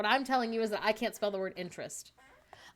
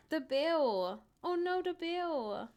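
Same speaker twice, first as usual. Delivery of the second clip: high, drawn-out mock distress; slow, babyish mock dismay; baby-talk bit; 5 semitones higher, much slower than usual. Streamer re-enacts an old accent bit, in babyish mock woe. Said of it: "I think it was us being like".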